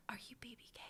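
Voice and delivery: timid whispered question. Retold or imitated. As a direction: whisper it like a shy fan. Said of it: "she whispers".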